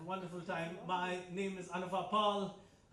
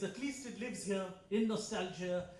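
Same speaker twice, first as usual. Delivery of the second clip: ordinary delivery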